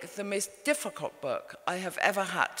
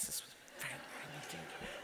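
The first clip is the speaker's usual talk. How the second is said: hushed aside; 19 dB quieter than usual. Real, not acted. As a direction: lean in and drop it to a conspiratorial hush. Mother drinking neat whiskey, hushed aside.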